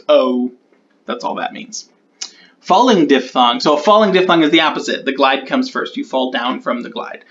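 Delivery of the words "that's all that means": muttered aside. Real, not acted. Aside muttered under breath.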